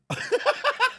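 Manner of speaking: high burst of laughter; incredulous laugh. Real, not acted. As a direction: laugh in disbelief. Laughing at the absurdity.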